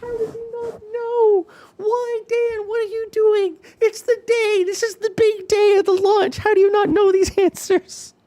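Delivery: very high, hammed-up squeal of disbelief; shocked high voice. Streamer reacting to the launch-day answers, shocked high voice. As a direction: send the voice way up in mock shock. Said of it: "My voice has to go this high because I am so shocked".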